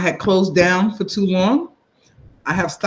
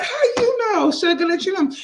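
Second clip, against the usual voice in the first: put-on much higher, teasing old lady voice; grandmother's voice; 12 semitones higher than usual. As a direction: sweetly tease the child as grandma. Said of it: "And they were like".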